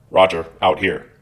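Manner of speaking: lightly voiced orderly-room phone manner; military phone sign-off. Socially inept guy ending a friend's call, military phone sign-off. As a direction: sign off like a soldier on duty. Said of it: "like they're handling the phone in the company orderly room".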